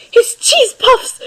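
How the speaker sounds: high, loud, slow panicked wail; alarmed cry. Acted. Grell panics over his cheese puffs, high frantic wail. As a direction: shriek it like your snack is in danger. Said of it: "Grell dropped his chainsaw, rushing forward".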